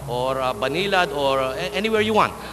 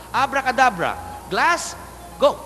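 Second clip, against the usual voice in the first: high, grand magic command; magician's incantation; 6 semitones higher than usual. Acted. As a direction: call it out like a stage magician. Magician casts the trick.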